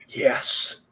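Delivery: low, whispered ghostly answer; hushed, voiceless reply from beyond; imitated spirit voice. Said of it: "And it goes".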